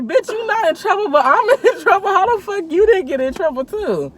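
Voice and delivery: hammed-up indignation at her friend; mock outrage. Girl yelling at her friend who got off free, half-laughing mock outrage.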